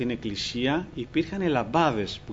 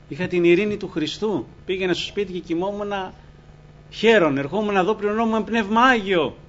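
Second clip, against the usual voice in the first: higher, louder, lifted preaching; joyful testimony; 5 semitones higher, 4 dB louder than usual. Real, not acted. Preacher testifying to his peace in persecution, rising joy.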